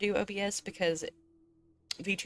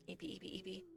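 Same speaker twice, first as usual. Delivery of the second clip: cutesy murmur; 16 dB quieter, much faster than usual. Real, not acted. Cutesy word for sleepy.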